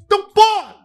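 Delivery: high, explosive shout; exasperated shout. Acted. Host urging listeners to bet, exasperated shout. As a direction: burst out loudly to cap the rant. Hyped betting pitch climax.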